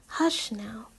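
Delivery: soft whispered hush; soothing hush. Acted. Simi rocking Alex to calm him, whispered hush. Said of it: "Simi says".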